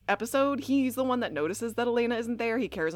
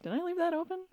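confused Elena; 9 semitones higher, 5 dB quieter, faster than usual. Retold or imitated. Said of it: "confused look on her face".

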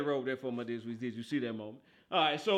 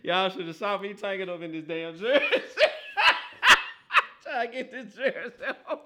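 talking through real belly laughs; helpless laughter breaking up the sentence; cracking up; 3 semitones higher, 5 dB louder than usual. Real, not acted. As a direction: laugh so hard the words squeeze out high. Cracking up over the jersey struggle.

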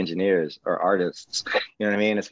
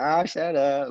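lightly imitated woman, pitched higher; higher-pitched retold reply, only lightly acted; 7 semitones higher, slower than usual. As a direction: quote her brush-off in a slightly raised, higher voice. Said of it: "and she's like".

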